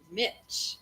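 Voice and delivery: ordinary delivery, nothing marked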